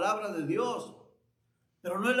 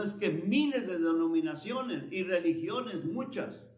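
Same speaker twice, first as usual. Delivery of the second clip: higher-pitched, insistent sermon delivery; raised preacher's voice driving each phrase home; emphatic preaching; 6 semitones higher than usual. Real, not acted. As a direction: preach it with urgent conviction.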